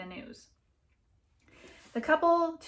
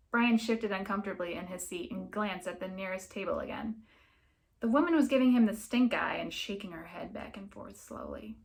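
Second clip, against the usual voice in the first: ordinary delivery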